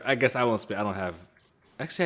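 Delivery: normal talking voice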